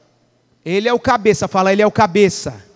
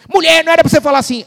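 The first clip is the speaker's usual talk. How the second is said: louder, fast, rebuking the wife; stern rebuke; 5 semitones higher, 7 dB louder, much faster than usual. Retold or imitated. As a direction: scold her quickly and firmly. (pastor repreendendo a mulher, alto e rápido)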